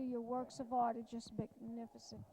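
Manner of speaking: usual speaking voice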